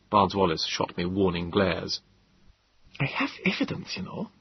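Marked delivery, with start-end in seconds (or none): 3.0-4.3 high, soft and urgent; intent hush. A narrator's reading. Gödel intently confides to the narrator, hushed. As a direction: press it quietly, intently. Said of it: "Gödel said intently".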